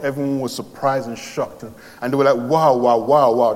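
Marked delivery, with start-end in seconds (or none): 2.4-3.5 louder, astonished wow; amazed wows. Retold or imitated. The crowd sees Lazarus come out, louder and astonished. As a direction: gasp it out in amazement.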